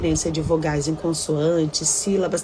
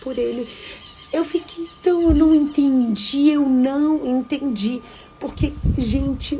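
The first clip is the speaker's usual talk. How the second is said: shocked disbelief; 7 semitones higher than usual. Real, not acted. Shocked, reliving the news.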